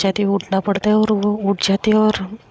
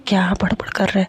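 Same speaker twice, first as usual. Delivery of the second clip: fast, breathy whisper of gossip; whispering in her ear; faster than usual. Retold or imitated. Nisha whispers to Mishka about her boyfriend, hushed and quick. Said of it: "Mishka के कान में बोलती".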